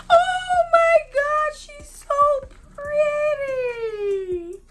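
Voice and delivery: very high, drawn-out, near-tearful squeal; slow wail of delight, stretched out high; ecstatic squeal. Real, not acted. Vlogger unrolling her new doll, squealing in delight. First full sight of doll.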